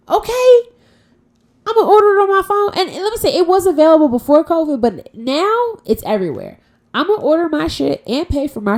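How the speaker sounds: high, loud and fast; hyped up. Real, not acted. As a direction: rant with big energy. Hyped rant about curbside.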